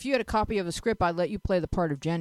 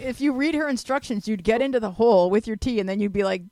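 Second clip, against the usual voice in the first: normal talking voice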